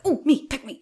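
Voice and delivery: high, loud keen-pupil plea; eager volunteering. Retold or imitated. Student begging the teacher to choose her, eager. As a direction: burst out as if desperate to be picked.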